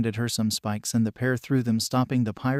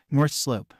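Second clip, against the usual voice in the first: plain reading voice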